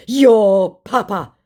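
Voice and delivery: slow, loud, high scammer impression; mock angry scammer. Scammer insulting the baiter back, mock angry. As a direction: drag out the insult in a put-on voice.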